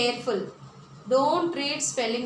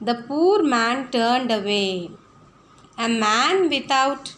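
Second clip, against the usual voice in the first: put-on slow, loud, careful reading to children; slow reading aloud; 5 dB louder, much slower than usual. Teacher reading the story to her pupils, slow and loud. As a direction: read each phrase out slowly and clearly for the class. Reads paragraph to class.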